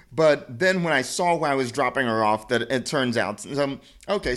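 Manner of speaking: normal talking voice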